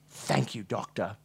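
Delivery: soft, sinking voice; drowsy thanks. A narrator's reading. Patient thanks doctor before closing eyes, softly fading. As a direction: let the voice drop away gently.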